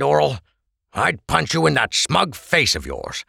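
lightly voiced Krebs, grumbling at his friend; a barman complaining half in jest.

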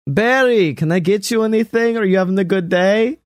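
light imitation of a friendly mobster; NoHo Hank. Retelling NoHo Hank cheerfully asking Barry. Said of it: "and he's just like".

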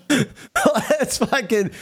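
slow words broken by real laughter; a slow laugh-soaked restart; laughing through words. Real, not acted. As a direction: try to talk but keep cracking up. Still laughing mid-sentence.

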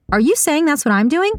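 high-pitched incredulous question; indignant and high, at normal volume; affronted challenge. A narrator's reading. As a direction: demand an answer, pitch climbing. Saga challenges the accusation.

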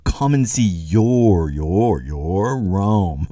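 "your" slowly drawn out and said three times; "your" repeated with deliberate, lingering emphasis; stress on YOUR. Shown as a demonstration. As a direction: lean hard on each "your" before "Rome". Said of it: "I just want to emphasize that word".

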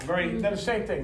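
ordinary talk

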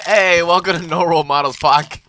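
upbeat host greeting; podcast intro. Acted. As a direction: open the show like a host. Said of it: "Intro your thing".

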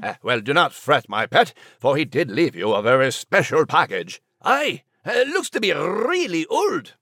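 Scottish brogue, soothing; Scottish dog comforting. Acted. Max the dog consoles Nigel, Scottish brogue. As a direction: reassure him in Scottish lilt.

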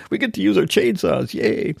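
mock super excited. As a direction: play the neighbors as giddy kids with new toys. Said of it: "like, super excited".

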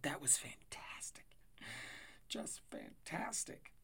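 slow, awed unvoiced whisper. Real, not acted. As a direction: hush it in awe.

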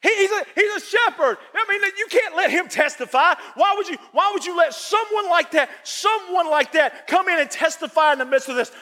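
high, indignant shout; outraged townsman. Retold or imitated. Townsman objecting to a shepherd testifying in court, high and indignant. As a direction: bristle with disbelief, pitched high. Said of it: "townspeople's outrage voiced".

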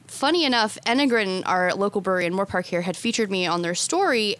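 ordinary delivery

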